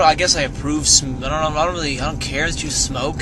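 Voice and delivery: normal talking voice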